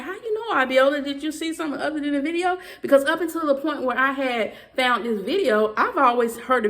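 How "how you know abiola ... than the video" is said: high-pitched, puzzled questioning; acting out a surprised question; retold incredulous questioning. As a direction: retell it as a puzzled challenge, pitched up. Retold questioning of Abiola in disbelief.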